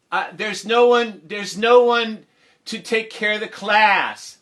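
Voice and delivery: high, loud, slow protest; talking to the speaker. Retold or imitated. Teacher telling the speaker nobody can mind class, high protest. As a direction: call up to the intercom, slowly and loudly. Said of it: "talked to the, the speaker like a real person".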